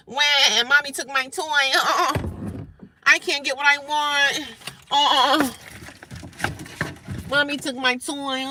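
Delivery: put-on high, loud, whiny child wailing; mock toddler tantrum. Mimicking the rude caller as a daycare kid, hammed-up tantrum. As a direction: bawl like a pretend two-year-old. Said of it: "Like a little kid at a daycare having a fit".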